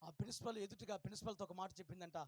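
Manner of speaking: normal talking voice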